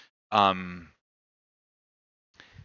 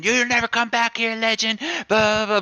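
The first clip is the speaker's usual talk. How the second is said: retold screaming; 12 semitones higher than usual. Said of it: "they're screaming".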